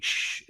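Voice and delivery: soft "shh" of escaping air; slow leak hiss. Retold or imitated. Imitates slow leak again.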